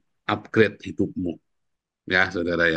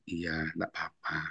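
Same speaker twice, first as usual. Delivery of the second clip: soft, slow, resigned okay; resigned shrug; 5 semitones lower, 13 dB quieter, slower than usual. Retold or imitated. Pastor answering the tattler, soft resigned. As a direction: let it go with a quiet sigh of a line.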